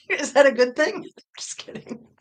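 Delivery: ordinary talk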